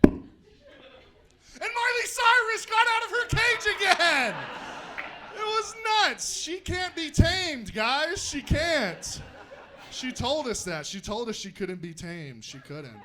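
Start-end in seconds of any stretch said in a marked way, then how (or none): 0.0-9.2 shouted higher, excited zoo report; bigger second take. Acted. Retelling the zoo joke, higher and louder. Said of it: "Okay, take two".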